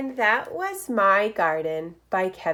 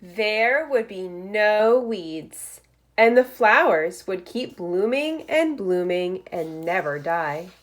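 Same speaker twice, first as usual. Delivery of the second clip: lively picture-book read-aloud; reading to children; 6 dB louder than usual. Acted. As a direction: read it like story time for first graders. Reads picture book aloud to class.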